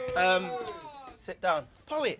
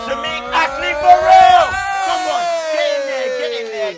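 loud, high, slow announcer shout; hype introduction; 8 semitones higher, 15 dB louder, slower than usual. Real, not acted. Host bringing on the guest with a loud, slow, high ring-announcer shout.

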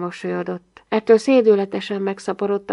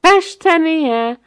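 much higher, loud and slow mocking disbelief; father ridiculing his son's painting; guffawing; 9 semitones higher, 7 dB louder, much slower than usual. A narrator's reading. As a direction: toss it back with a big scornful laugh. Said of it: "hahotázott az atya".

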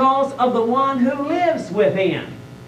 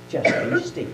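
very calm voice; 8 semitones lower, 3 dB quieter than usual. Acted. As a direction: lower and softer, gently quieting the water. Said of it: "in just a very calm voice".

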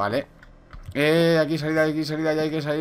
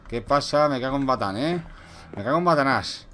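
ordinary talk

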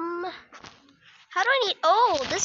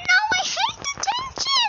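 whiny protest. Acted. Kid dreads detention.

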